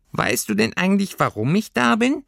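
high-pitched, inquisitive little creature; squeaky furry creature. A narrator's reading. Creature quizzing girl on why it came, squeaky. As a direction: pipe the question up.

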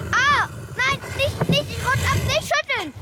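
high, panicky pleading; panicked. Acted. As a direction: plead while losing your grip. Slipping off the shaken animal's back.

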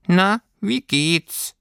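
hoch, langsam, leicht betrübt; trauriges Stimmchen. A narrator's reading. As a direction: frag gedehnt als trauriges Pelztierchen. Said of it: "fragte es traurig". (hohe, etwas geknickte Tierchenstimme)

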